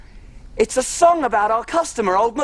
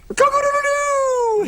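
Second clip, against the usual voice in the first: high rooster crow, held long. Pretend. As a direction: crow like a cockerel at dawn. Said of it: "I'm making farm animal noises".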